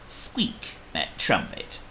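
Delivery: ordinary delivery, nothing marked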